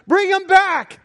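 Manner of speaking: very high, loud call. Retold or imitated. Jesus calling the parents back, very high and loud. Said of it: "the Lord's calling them".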